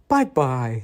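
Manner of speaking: put-on little-kid farewell, as if waving; cute toddler voice; imitated toddler. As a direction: imitate a small child signing off sweetly. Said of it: "He goes".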